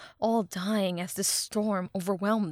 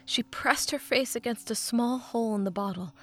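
ordinary delivery, nothing marked

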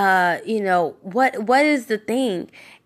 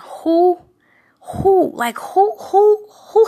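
who climbing high, a little louder; rising questioning who; 9 semitones higher, 3 dB louder, slower than usual. Acted. Preacher pressing his listeners to ask who. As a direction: throw each who up higher and higher.

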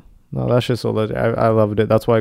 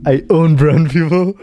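slow, higher hammy boast; mock boast; 4 semitones higher, 5 dB louder, slower than usual. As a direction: draw it out like a mock tyrant. Absurd joke continuation.

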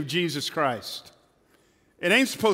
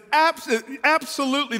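stammered eager reply; 10 semitones higher, 3 dB louder than usual. Acted. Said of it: "the man responds".

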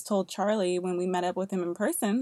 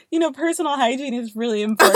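fast, loud laughing line; 4 semitones higher, 6 dB louder, much faster than usual. Retold or imitated. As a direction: blurt it out laughing. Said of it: "And I was like".

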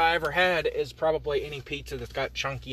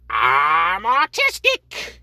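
shouted excuse; 6 semitones higher, 10 dB louder, much slower than usual. Acted. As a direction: belt it out stretched and rising. Shouted excuse in imagined exchange.

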